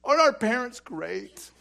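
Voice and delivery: high, loud, gleeful cry; giddy kids. Retold or imitated. Brothers cheering about their parents, high and gleeful. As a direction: burst out with relief and joy. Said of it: "my brother and I go".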